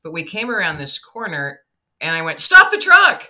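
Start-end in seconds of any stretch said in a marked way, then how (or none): 2.4-3.2 loud, high yell to stop the truck; shouted command. Retold or imitated. Yelling at her brother to stop the truck, loud and high. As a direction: bark it out, urgent and sudden.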